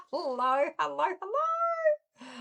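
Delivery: high, slow, drawn-out greeting; sing-song hello. Real, not acted. Host greeting her live viewers with a high sing-song hello.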